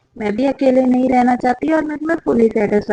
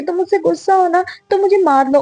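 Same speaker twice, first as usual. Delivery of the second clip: higher, mildly feigned pleading; put-on martyr act; 6 semitones higher, faster than usual. A narrator's reading. Mistress offering herself to the angry wife, mildly put-on. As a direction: play the wronged one lightly. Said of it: "नाटक करते हुए कहने लगती है".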